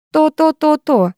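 high-pitched clucking mimicry, dropping on the last; mimicking taunt. A narrator's reading. Boy imitating the girl like a hen, mocking. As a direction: tease by aping her repeats. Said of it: "передразнил меня Роб".

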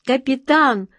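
high, loud call to the captain; excited report. A narrator's reading. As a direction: announce good news in a high voice. Sailor reports rescue to captain.

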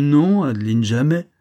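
pompous show of righteous outrage; hammed-up virtuous indignation. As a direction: play the offended saint, a bit loud. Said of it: "dans le transport d'une vertueuse indignation".

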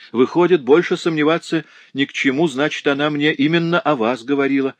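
high, loud, eager conclusion; excited realisation. Acted. Neighbour realises who was meant, excited. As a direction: burst out with the discovery.

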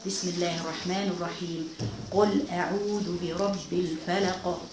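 slow, measured Quranic chanting, drawn out; Quran recitation. Acted. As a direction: recite each verse slowly for the women following silently. (تلاوة قرآن بطيئة ومرتلة)

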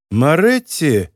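ordinary delivery, nothing marked

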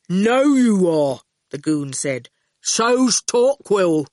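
slow, terse thug; slow goon voice. Acted. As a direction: plod out each word. The goon's terse voice.

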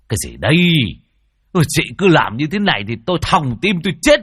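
jolted awake and grumbling; startled. Acted. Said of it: "Lão giật mình". (giật mình quát lên)

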